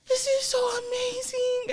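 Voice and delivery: high, sniffly gush; teary mom. Retold or imitated. Mom on the phone sniffling, high teary gush.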